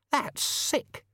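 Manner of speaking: high, appalled verdict; appalled woman's high voice; Susan, disgusted. A narrator's reading. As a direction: say it in disgust, pitched right up.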